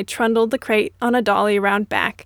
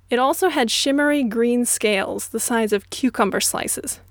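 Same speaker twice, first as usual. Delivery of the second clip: usual speaking voice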